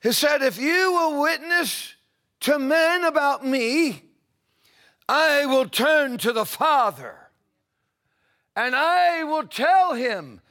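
loud, high, soaring preacher's cry; preaching at full force. Acted. Preacher lifting the congregation into prayer, full force. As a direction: lift the room, stretching the key words slow.

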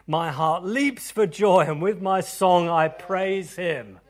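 loud, slow, exultant scripture; loud psalm of praise; fervent reading. Acted. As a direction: proclaim it with joy. Reading psalm with rising fervour.

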